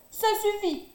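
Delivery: high indignant outburst; angry cry. A narrator's reading. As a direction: snap it, soaked and offended. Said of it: "s'écrie-t-il tout ruisselant". (Hiawatha vexé s'écrie, en colère)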